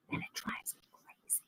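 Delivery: barely audible whispered aside; confiding whisper. Real, not acted. Admitting her daughter's likeness drives her crazy, in a whisper. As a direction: whisper it like a secret admission.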